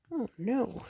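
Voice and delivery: plain reading voice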